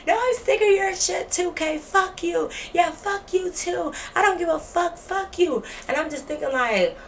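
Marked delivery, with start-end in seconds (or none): 0.1-5.6 high-pitched cussing rant; imitated going off. Roommate cussing out storyteller, high mocking imitation of going off. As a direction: mimic her ranting in a much higher voice. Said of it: "Becky started going off. She cussing".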